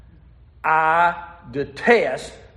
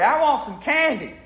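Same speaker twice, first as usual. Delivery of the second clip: put-on higher, louder little girl whine; 8 semitones higher than usual. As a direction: whine it higher like a fed-up child. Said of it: "get a little bit louder in this case".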